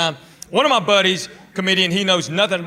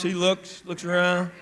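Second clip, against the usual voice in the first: ordinary delivery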